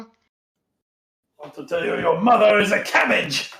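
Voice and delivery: put-on French accent, hurling a silly insult; lightly hammed French-accented jeer; mock French taunt. Second film taunt, put-on accent.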